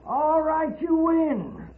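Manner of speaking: high, strained surrender; giving in. Acted. Old outlaw finally gives in to Paladin, high and strained. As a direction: give up with a pained, high voice.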